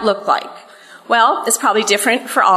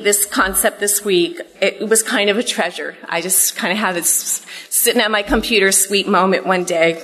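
usual speaking voice

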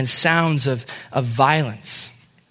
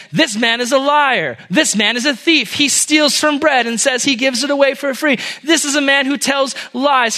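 high, raised denunciation; crying out accusations; 7 semitones higher than usual. A narrator's reading. Enemies of the king accuse the prince before the crowd, crying out. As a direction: denounce him to the whole crowd, pitched high. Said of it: "And they cried out".

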